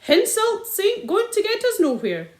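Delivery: dropped and added h's, rustic policeman; country sergeant accent. Acted. Village sergeant calming the angry men in rustic accent. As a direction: play the village sergeant broadly. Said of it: "said Sergeant Samways".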